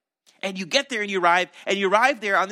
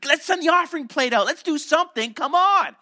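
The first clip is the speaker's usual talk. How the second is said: much higher, louder, frantic pleading; distraught disciples; 10 semitones higher, 7 dB louder than usual. Acted. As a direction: panic at the wasted crowd, voice shooting up. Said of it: "his disciples are so distraught".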